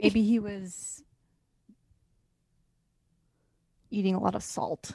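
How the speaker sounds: long pause after "Maybe he was"; dramatic pause. Real, not acted. Co-host excusing actor's bloating, dramatic pause after "was". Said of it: "Very dramatic pro-, pause".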